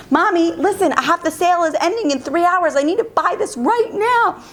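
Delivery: high, insistent pleading; urgent daughter, imitated. Daughter pleads about ending sale.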